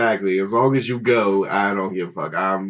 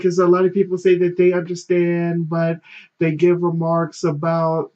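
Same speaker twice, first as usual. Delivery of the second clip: mock girlfriend voice; 7 semitones higher than usual. Girlfriend voiced, raised pitch.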